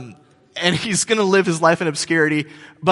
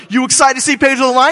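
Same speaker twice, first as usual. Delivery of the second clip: higher, louder rallying call; hype question; 7 semitones higher, 7 dB louder than usual. Retold or imitated. Opening act pumping up the crowd. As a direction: pump up the crowd. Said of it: "he's like".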